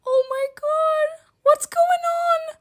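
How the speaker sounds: high, slow, panicked shriek; panicked cry. Acted. She yells at Kane while they plunge down, high and panicked. Falling from the sky.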